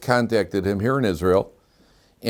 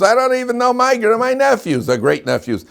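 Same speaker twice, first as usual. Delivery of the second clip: incredulous; 14 semitones higher, 6 dB louder than usual. Real, not acted. Incredulous aside to listeners.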